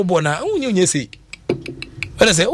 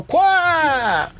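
a sudden high shouted "kwa"; mock shout; 11 semitones higher than usual. Imitating the trotro driver with a high shout. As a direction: let out a sharp, high cry like the driver.

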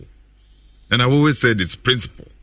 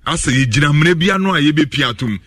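ordinary talk, nothing marked

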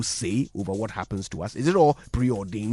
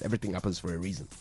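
mock platitude; 5 semitones lower, 9 dB quieter, much faster than usual. Mock platitude after patient dies.

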